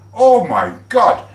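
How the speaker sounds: high, loud, slow amazed cry; stunned oh my God. Real, not acted. Marvelling to viewers at Vincent's size, amazed cry. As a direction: let it out in disbelief.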